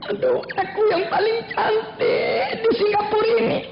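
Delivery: hammed-up swoon, high-pitched; mock swooning. Aminah reading her sweetheart's letter, mock swooning. Girl gushing over love letter.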